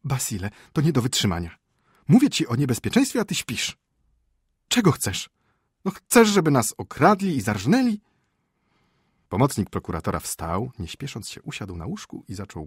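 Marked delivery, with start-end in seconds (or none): 0.0-3.8 high, agitated scolding; rising indignant alarm; exasperated wife. Acted. Wife exasperated with sleeping husband. 4.7-5.3 high, loud retort; exasperated, high-pitched echo; indignant wife. Acted. Wife throws his question back. 5.8-8.0 very high, loud scolding; shrieky exasperated outburst; indignant, frightened wife. Acted. Wife scolding husband in panic.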